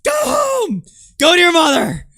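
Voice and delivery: shrieking high mock rant at the girlfriend; mocking the streamer yelling at his crying girlfriend, high-pitched screaming. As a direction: scream it in a shrill, exaggerated parody of him. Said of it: "He's just screaming at her".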